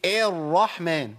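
الرحمن opened with a long eh instead of a; exaggeratedly light, stretched e starting الرحمن; over-thinned first vowel. Shown as a demonstration. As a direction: tilt the first a of الرحمن toward ee and hold it. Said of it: "هو عايز يرقق فيبالغ قوي".

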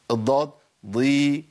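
"ضي" heavy, with a long-held "ee"; heavy itbaq ض. Shown as a demonstration. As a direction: draw out an emphatic "ضي" slowly. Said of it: "الإطباق بيضيف تفخيم للحرف". ("ضي" بضاد مفخمة ممدودة)

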